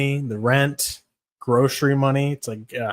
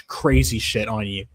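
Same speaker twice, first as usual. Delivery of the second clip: ordinary talk, nothing marked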